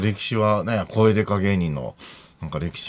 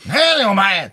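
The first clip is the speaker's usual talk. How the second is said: high, loud Kansai-style retort; mock-outraged comeback shouted at full voice; imitated loud tsukkomi; 9 semitones higher, 6 dB louder than usual. Junior comic snapping back at his senior, imitated shout. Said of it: "とかって言ってくれるから".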